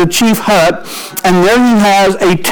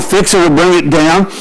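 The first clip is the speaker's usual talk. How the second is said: normal talking voice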